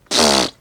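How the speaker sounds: short lip-buzzing pfft; blown raspberry. Retold or imitated. Aunt and baby's noise game, short blown raspberry. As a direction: blow a quick playful raspberry. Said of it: "And then we go".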